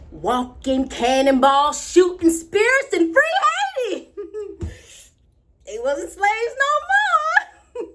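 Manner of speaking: high, loud, triumphant storytelling; exultant. Acted. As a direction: build to a high, laughing cry of victory. Girl exults over slave army.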